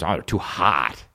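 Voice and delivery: low, slow whiny buddy voice; imitating buddy. Buddy complaining the fries are too hot, mock whine. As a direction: mimic his friend's lame excuse. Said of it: "And he goes".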